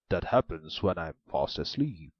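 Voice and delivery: the ogre explains. A narrator's reading.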